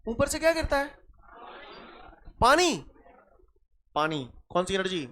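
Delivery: ordinary delivery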